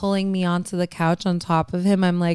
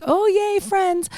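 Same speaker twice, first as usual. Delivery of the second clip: mock excited; 12 semitones higher, slower than usual. Sarcastic mock excitement at friendship.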